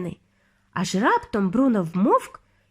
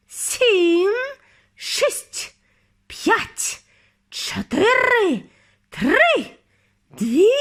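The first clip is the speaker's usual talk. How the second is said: rising, louder witch countdown; frenzied countdown; 8 semitones higher, 5 dB louder, much slower than usual. A narrator's reading. Grand High Witch counts down to the transformation, building to a shriek.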